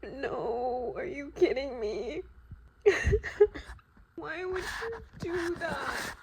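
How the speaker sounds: high-pitched crying protest; tearful disbelief. Real, not acted. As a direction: wail the question through sobs. Crying over the book.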